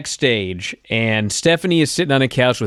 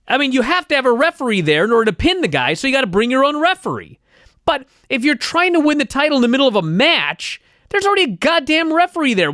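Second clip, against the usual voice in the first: high, heated exasperated rant; 10 semitones higher than usual. Real, not acted. Fan ranting to cohost about needless referees, high heated rant. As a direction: build the outrage, pitch way up.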